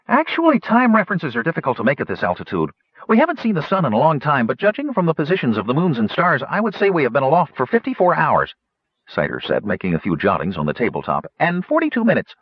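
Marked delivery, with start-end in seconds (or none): 0.1-8.5 very high, quick, eager gnome voice; excited gnome. Acted. Citer announces where the ship is heading, very high and eager. 11.4-12.3 very high, quick, eager gnome voice; excited gnome. Acted. Citer announces where the ship is heading, very high and eager. Citer adds precise figure.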